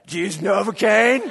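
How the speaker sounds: chuckling question, half laughing; laughing dad. Retold or imitated. Dad laughing at his son, mock question.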